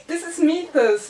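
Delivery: high giddy laugh into the title. Real, not acted. Holding up Mythos, she laughs giddily. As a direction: laugh with joy as you name it. Laughing while showing book.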